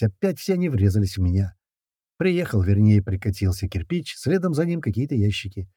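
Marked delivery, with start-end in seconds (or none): none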